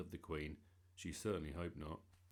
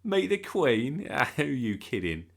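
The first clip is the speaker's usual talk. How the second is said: loud, high jeer broken by a laugh; laughing disbelief; 7 semitones higher, 17 dB louder than usual. A narrator's reading. Boy mocking his friend's boast about the Queen, laughing. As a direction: scoff through laughter. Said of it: "Darren burst out laughing".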